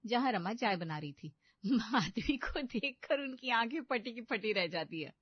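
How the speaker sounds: ordinary talk, nothing marked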